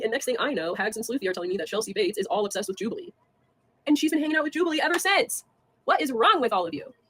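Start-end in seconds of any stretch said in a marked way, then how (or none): 3.9-6.9 high, loud, incredulous rant; exasperated outburst. Real, not acted. Vlogger berating her detractors over Jubilee, exasperated. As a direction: burst out in exasperation at them.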